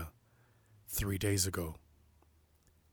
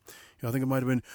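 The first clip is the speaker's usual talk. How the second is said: usual speaking voice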